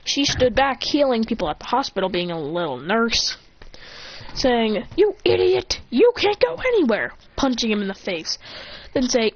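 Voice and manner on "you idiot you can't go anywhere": high, louder furious yell; shrill-free loud telling-off; angry scolding. Acted. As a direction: scold him like a fed-up teammate. Sakura scolding and punching Naruto.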